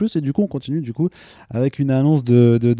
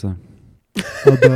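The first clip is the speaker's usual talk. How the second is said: put-on English accent French; 2 semitones higher, 7 dB louder than usual. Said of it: "Non, il a un accent anglais".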